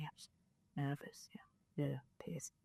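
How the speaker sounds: hushed, breathless whisper; barely voiced nervous whisper. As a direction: whisper it like you're scared. Nervously telling piercer she's fine.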